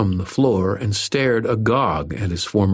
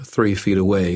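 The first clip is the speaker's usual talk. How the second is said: ordinary delivery, nothing marked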